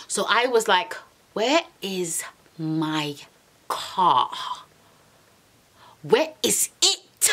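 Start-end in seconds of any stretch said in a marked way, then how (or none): 1.3-7.3 very slow, word by word, with long pauses; slow, controlled demand. Retold or imitated. Girlfriend demanding car after being brushed off, slow and controlled. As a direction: spell out the demand with restrained fury.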